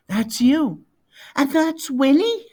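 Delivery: lightly voiced child, amazed and rising; a hint of little boy. A narrator's reading. Young son realising the bear is real, lightly boyish amazement. Cole marvels at the story.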